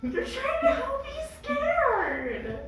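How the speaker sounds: high squeaky retort; tiny kobold voice. Acted. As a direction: argue back in a tiny squeak. Kobold argues with the cat.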